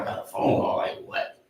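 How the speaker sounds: puzzled whisper, barely voiced; half-whispered disbelief at a sudden call; baffled hush. At work, told of a phone call, whispered puzzled disbelief.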